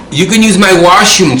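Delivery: loud, high teasing offer; imitating a joking friend; smart-ass offer. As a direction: pipe up loudly to tease a buddy. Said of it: "his smart ass".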